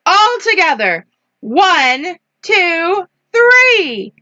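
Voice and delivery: rising, high-pitched countdown; storybook team cheer; rallying count. A narrator's reading. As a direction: build excitement up to three. Story count for the big push.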